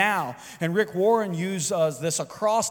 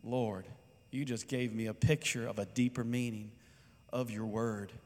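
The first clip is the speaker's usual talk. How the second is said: low, soft words to the Lord; hushed prayer; 6 semitones lower, 9 dB quieter than usual. Retold or imitated. Preacher retelling his prayer to the Lord, hushed reverence. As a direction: speak quietly and reverently to God. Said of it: "and I say".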